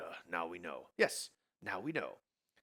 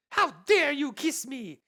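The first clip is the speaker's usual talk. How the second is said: Elsa voice; 11 dB louder, slower than usual. Acted.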